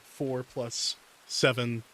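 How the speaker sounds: ordinary delivery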